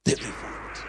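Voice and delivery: whispered echo. Acted. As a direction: breathe it out as a secret. Soft echo after preached line.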